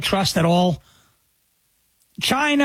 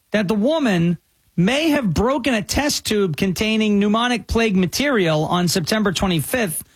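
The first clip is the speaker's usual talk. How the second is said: usual speaking voice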